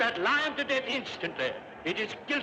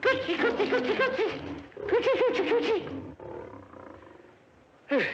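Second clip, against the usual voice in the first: put-on high, cooing baby talk to a pet; high nervous cooing; 9 semitones higher, faster than usual. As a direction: coo at the lion in a high voice. Emperor coos to lion.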